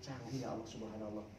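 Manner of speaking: soft, low private marvelling; musing to himself. Retold or imitated. Husband silently marvelling at his nagging wife, musing softly. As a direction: murmur it like a thought kept to yourself. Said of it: "tatap wajahnya sambil mikir".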